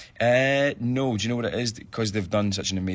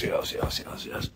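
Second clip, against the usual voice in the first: what else, fading into a whisper to herself; trailing-off whisper; faster than usual. Real, not acted. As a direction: mutter it away under the breath until it's gone. Mulling over next fan question.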